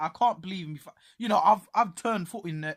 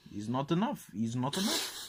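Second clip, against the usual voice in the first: a quieter, lightly voiced Mourinho, rising at the end; a hint of the Portuguese manager, understated; Mourinho impression; 4 semitones lower, 7 dB quieter than usual. Mocking the manager's complaint about his striker, lightly voiced. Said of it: "Mourinho saying".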